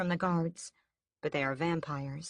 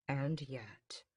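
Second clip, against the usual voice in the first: softer, menacing tone; low and dangerous; 3 semitones lower, 8 dB quieter than usual. A narrator's reading. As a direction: lower your voice into a threat. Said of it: "I lower my tone and make it dangerous".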